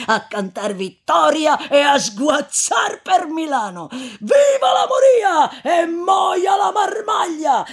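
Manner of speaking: high, loud, slow rowdy toast; boisterous exclaiming. Acted. Drunken corpse-carrier toasting the plague, rowdy shout. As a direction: crow it out like a victory song. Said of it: "esclamò l'altro".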